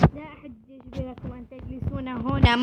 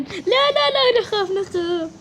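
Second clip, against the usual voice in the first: high, loud pretend panic; hammed-up fear, much higher; mock scared kids; 6 semitones higher, 5 dB louder, slower than usual. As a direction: squeal it like frightened children. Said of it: "خوفتهم".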